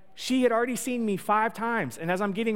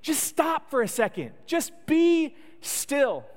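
higher, slow, drawn-out still; higher, exasperated plea; 7 semitones higher, slower than usual. Pretend. Jesus telling the storm to stop, higher and drawn out. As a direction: plead for calm, stretching the last words. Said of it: "It's as if Jesus is saying".

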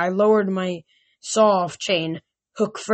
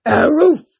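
dog bark; 7 semitones higher, 8 dB louder than usual. A narrator's reading. Dog barks.

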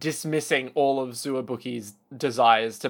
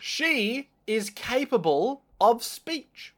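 slow and higher, drawn-out deadpan; mock solemn praise; 8 semitones higher, much slower than usual. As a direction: list it like a grand eulogy. Mock listing Nanami's virtues.

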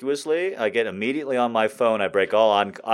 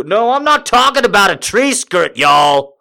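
pushy saleswoman; 9 semitones higher, 7 dB louder than usual. Retold or imitated. Saleswoman correcting him, Southern.

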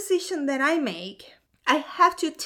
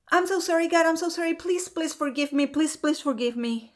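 higher, rushed mock begging; scared apology; 4 semitones higher, faster than usual. Apologizing to God for screaming at him, scared pleading. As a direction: beg forgiveness in a retold panic. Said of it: "I was scared".

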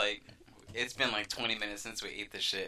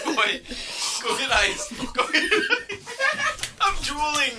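high, loud, panicked laughing; frantic laughing shout; 7 dB louder than usual. Real, not acted. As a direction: yell for ice while laughing. Burning mouth, begging for ice.

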